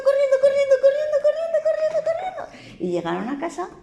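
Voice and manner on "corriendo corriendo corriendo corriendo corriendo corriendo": very high, quick running chant; high chant of the pig running; running fast. A narrator's reading. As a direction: chirp each corriendo higher. Pigs running away.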